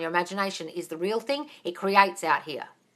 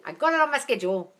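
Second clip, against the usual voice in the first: ordinary talk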